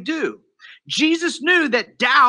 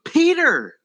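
higher-pitched call of a name; pointed address; 7 semitones higher than usual. Retold or imitated. Jesus to Peter as he grabs him, pointed address.

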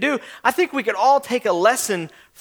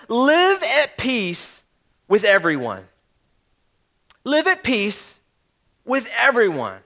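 slow verse reading with pauses; slow, deliberate; slower than usual. Real, not acted. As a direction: give each phrase room, unhurried. Reads the key verse twice.

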